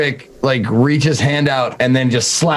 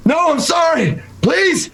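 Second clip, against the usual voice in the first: much higher, panicked cry; terrified plea. Acted. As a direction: cry out in terror before running. Character panics and flees.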